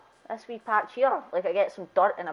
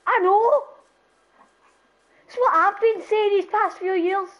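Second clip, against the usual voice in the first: excited exclamation; 10 semitones higher than usual. Real, not acted. Exaggerated agreement.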